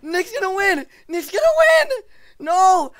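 high-pitched, loud frustrated wailing; mad. Real, not acted. Player watching Nick about to win, mad yelling. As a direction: scream it in outraged disbelief. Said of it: "I'm so mad right now".

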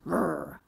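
low quiet growling; a hungry lion's rumble; soft growl. Acted. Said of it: "He growled".

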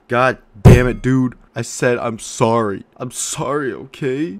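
loud exasperated mock rant; mock angry outburst. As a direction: blow up at the fans in fake anger.

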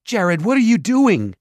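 high, loud frantic cry; panicked mother. A narrator's reading. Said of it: "Their mother's voice was panicked".

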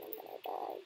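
breathless whisper of fear; scared whisper. Real, not acted. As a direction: whisper it, terrified of being found. Scared while hiding.

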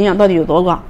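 talking while biting food; muffled by chewing; mouth full, chewing corn. Real, not acted. Seen 0: Front speaker bites purple corn at mouth, chews while speaking.

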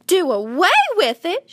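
put-on high, indignant girl's shriek; shrill-pitched outburst of a shocked child. A narrator's reading. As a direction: shriek it in horrified disbelief. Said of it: "shrieked Fern".

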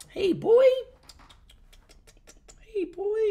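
high-pitched cooing call; pet-calling voice. Real, not acted. Streamer calling his cat over, high pet voice.

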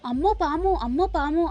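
startled by a snake, cry out lightly; scared yelling. A narrator's reading. Charu, hit by the fake snake, mock frightened cry. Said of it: "గట్టిగా అరుస్తూ".

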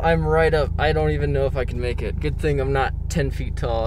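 ordinary talk, nothing marked

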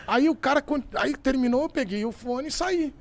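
ordinary delivery

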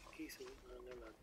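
very soft, frightened whispering; startled, then creeping quiet; scared whisper. Real, not acted. Scared at dead body. (sussurro com medo)

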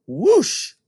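high rising whoosh sound; rushing wind noise. A narrator's reading. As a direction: blow it like a gust. Wind blowing house down.

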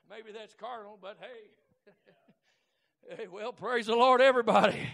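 normal talking voice

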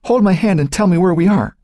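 high, urgent plea; begging. A narrator's reading. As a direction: plead in a frightened girl's voice. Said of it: "she begged".

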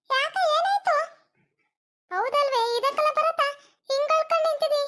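high-pitched, worried questioning; concerned surprise. Acted. Adult asking crying Bharata what happened, concerned surprise.

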